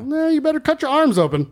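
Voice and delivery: very high mocking squeak; high squeaky voice. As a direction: squeak it like a cartoon bat. High squeaky bat god.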